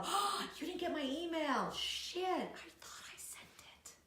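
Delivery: high, then dropping to a whisper; feigned innocence. Retold or imitated. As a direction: fake surprise, trailing into a hushed excuse. Acting out the fake email excuse.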